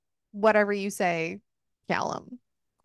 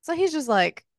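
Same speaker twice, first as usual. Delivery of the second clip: ordinary talk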